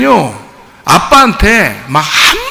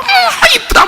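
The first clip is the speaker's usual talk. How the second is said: much higher cooing dad voice; doting dad; slower than usual. Retold or imitated. Dad cooing at his daughter before a kiss, hammed-up. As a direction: coo over the daughter like a smitten father. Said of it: "딸내미한테 막 뽀뽀를 한단 말이야".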